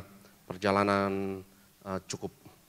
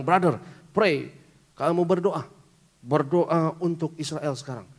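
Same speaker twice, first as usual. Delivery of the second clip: urging driver; 5 semitones higher, 4 dB louder than usual. Retold or imitated. Driver urges the pastor to pray for Israel, high and urgent. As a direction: call him to pray, pressing. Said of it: "Dia bilang".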